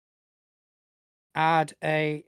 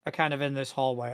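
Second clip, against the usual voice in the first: ordinary delivery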